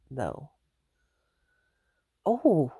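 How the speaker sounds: normal talking voice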